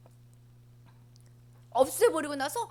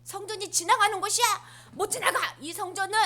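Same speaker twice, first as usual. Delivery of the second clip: loud, high blocking shout; angry shout; 8 semitones higher, 5 dB louder than usual. Acted. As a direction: forbid them to pass, furious. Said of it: "성전에 가서 화내신다".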